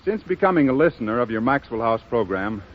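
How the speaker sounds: ordinary talk, nothing marked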